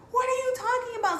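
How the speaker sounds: high, loud incredulous outburst; indignant retort. Retold or imitated. Woman answering a stranger who says she dishonours family, retold loud indignant outburst. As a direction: fire back, voice shooting way up.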